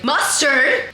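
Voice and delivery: mock loud, rising "Mustard?"; retelling the counter guy mishearing her, mock loud; imitating loud clerk. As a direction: ape the clerk shouting "Mustard?". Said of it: "super loud".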